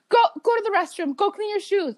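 high, fast, urgent teacher voice; hurried teacher. Retold or imitated. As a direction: shoo the kid off to clean up. Teacher sending her away.

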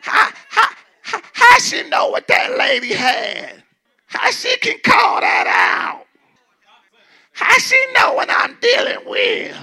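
very high, slow, crying mock voice; hammed-up high wailing disbelief; mock wailing gossip. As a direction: wail it like scandalized neighbors. Mocking townsfolk.

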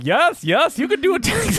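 high-pitched hammed-up director impression; Renny Harlin. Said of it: "And you think Renny Harlin".

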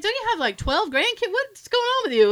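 high-pitched mock disbelief; incredulous questioning. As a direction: question her like you can't believe it. Acted incredulous question to pregnant coworker.

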